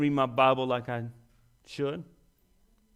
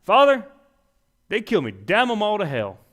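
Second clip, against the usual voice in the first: loud, higher, furious cry to heaven; angry outburst; 6 semitones higher, 7 dB louder than usual. Acted. Preacher voicing the wrong words Jesus never said, loud and furious. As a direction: shout it out in bitter rage.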